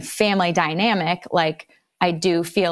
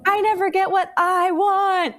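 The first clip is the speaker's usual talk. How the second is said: put-on high whiny child's complaint; mock crying whine; 12 semitones higher than usual. Mother imitating her crying son's complaint, high whine. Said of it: "like, crying".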